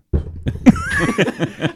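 high-pitched laugh. Real, not acted. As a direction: squeal of laughter. Laughing mid-story.

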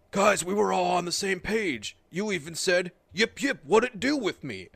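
mock wrestler voice. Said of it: "it's like".

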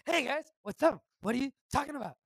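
high, loud, eager son voice; Shane O'Mac. Acted. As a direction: pop in as the boss's upbeat kid. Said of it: "Shane O'Mac enters the room".

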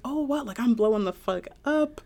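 high-pitched imitated boast; hyped-up quote of a boaster; mock bragging. Voicing the rapper's boast.

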